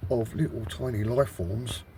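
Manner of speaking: usual speaking voice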